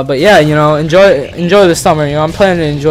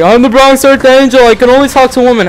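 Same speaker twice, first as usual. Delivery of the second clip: high, loud put-on voice of a self-important weirdo; creepy stranger voice; 9 semitones higher, 5 dB louder than usual. Vlogger mocking the stranger who hit on his mom, high and loud. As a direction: pitch it way up and announce yourself grandly. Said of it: "He's like".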